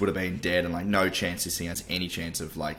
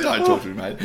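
much higher, laughing through a fake sulk; laughing mock rebuff; 13 semitones higher, 5 dB louder than usual. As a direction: cackle out a pretend brush-off. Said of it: "I was like".

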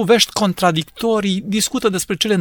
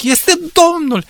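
joyful recognition; 10 semitones higher, 3 dB louder, slower than usual. Acted. Disciples recognize risen Christ.

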